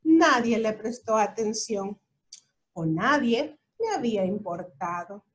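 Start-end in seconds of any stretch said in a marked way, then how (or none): none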